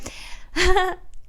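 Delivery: high burst of laughter; high giggle. Real, not acted. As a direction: laugh at yourself.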